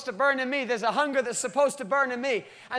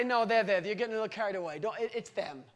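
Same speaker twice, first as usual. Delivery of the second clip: softer, lower, casual brush-off; a soft shrugging mock reassurance; mock dismissive God; 3 semitones lower, 6 dB quieter than usual. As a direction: wave the worry away lazily. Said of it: "He didn't come and down and say".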